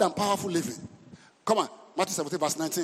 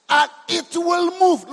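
slow, higher and louder; raised voice; 9 semitones higher, 7 dB louder, slower than usual. Real, not acted. Preacher loudly declares the mountain will move. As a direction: declare it firmly.

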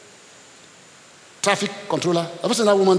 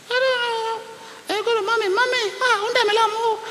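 put-on high childish voice; scared little child. Pastor as a child crying to mommy, high childlike. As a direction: play the frightened small child. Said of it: "Because me".

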